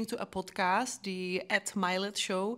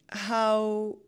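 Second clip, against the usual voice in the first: usual speaking voice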